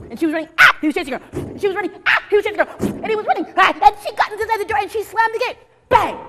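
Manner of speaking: high, loud, breathless excitement; yelled climax with little screams; frantic chase narration. A narrator's reading. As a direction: build the chase to a shouted bang.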